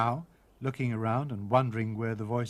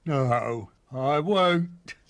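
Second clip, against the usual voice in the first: gruff wolf; 6 dB louder, much slower than usual. Acted.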